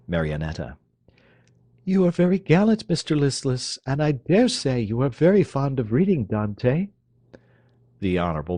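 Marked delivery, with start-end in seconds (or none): 1.8-6.9 high, arch teasing voice; teasing young lady. Acted. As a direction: tease the dandy. Marionetta teasing Listless.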